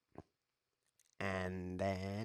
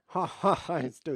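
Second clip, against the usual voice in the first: a high, loud burst of laughter; gleeful laugh; 9 semitones higher, 9 dB louder, slower than usual. Real, not acted. Streamer laughing at his chess trick, gleeful. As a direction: laugh at his own cheeky move. Gloating at his opening win.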